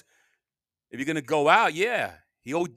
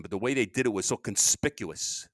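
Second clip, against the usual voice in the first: ordinary talk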